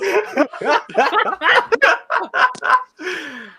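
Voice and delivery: long, high peal of laughter; big laugh that tails off. Real, not acted. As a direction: laugh hard and loud. Group cracks up at the scene.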